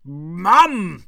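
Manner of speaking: embarrassed cry. Acted. Embarrassed son protests.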